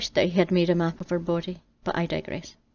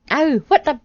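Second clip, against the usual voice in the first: sudden loud ow, up high; pained yelp; 8 semitones higher, 8 dB louder than usual. Acted. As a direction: yelp in surprised pain. Adam in sudden pain.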